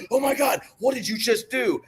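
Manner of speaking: high shocked cry; stunned disbelief, voice shooting up. Retold or imitated. Doctors see the patient drain the bottle, shocked and high.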